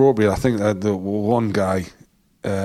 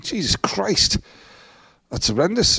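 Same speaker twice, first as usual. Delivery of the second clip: much higher, shocked gasp; shocked exclamation; 9 semitones higher than usual. Retold or imitated. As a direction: react in disbelief. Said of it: "I was like".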